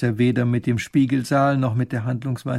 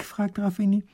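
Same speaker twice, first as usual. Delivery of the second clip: plain reading voice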